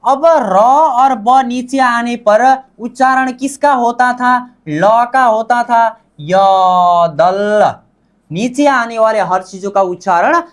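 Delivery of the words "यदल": यदल very slowly drawn out, in a lower pitch; modelled pronunciation. Shown as a demonstration. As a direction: stretch यदल into a long ya, then dal. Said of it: "इसका उच्चारण क्या होगा".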